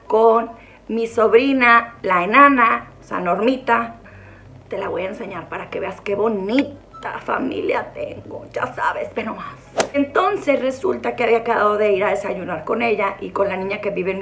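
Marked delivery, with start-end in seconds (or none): 4.7-9.6 soft, breathy aside; confiding in the viewer in a hush; whisper. Real, not acted. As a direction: whisper as if sharing a secret. Showing family photo secretly.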